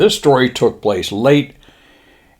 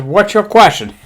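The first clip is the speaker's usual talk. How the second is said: high mock-Japanese admiral voice; 11 semitones higher, 3 dB louder than usual. Admiral briefing his pilots, high mock-Japanese voice. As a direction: announce it in a put-on Japanese accent. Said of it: "He says".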